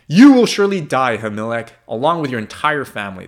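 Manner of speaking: loud, raging death sentence; king shouting. A narrator's reading. King Saul shouting a death sentence at Ahimelech and his family. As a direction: roar the death sentence at him. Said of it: "the king shouted".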